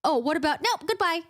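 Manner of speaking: high, quick dismissive Farrah; breezy brush-off. Retold or imitated. Farrah brushing off Tamlin's offer, high breezy dismissal. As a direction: wave him off lightly and flee. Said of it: "She's like".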